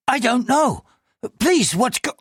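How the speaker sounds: high, anxious pleading voice; a frightened man answering the police; nervous clerk. Acted. Nervous clerk answers the superintendent in a high, anxious voice.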